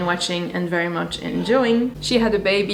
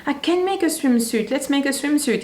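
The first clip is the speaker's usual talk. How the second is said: excited self-quote; 8 semitones higher than usual. Retold or imitated. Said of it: "and I'd be like".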